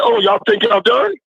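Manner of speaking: high, incredulous coach challenge; retold coach, voice lifting high; coach's challenge. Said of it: "he looked at us and said".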